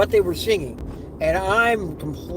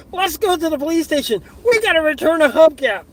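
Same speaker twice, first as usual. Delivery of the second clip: high, loud call to action; rally the group for a mission; excited rallying cry; 8 semitones higher, 6 dB louder than usual. Retold or imitated. Rallies passengers for a mission.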